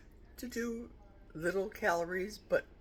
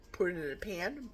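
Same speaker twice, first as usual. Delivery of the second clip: ordinary talk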